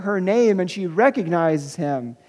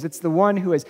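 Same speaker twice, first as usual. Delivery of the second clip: ordinary talk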